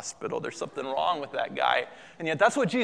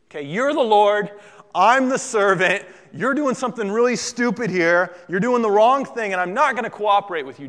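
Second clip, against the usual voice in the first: loud, raised, insistent protest; defiant Peter; 4 semitones higher, 8 dB louder than usual. Acted. Peter refusing to cooperate with Jesus, loudly defiant. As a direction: push each clause out in acted indignation. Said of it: "what he's really saying here".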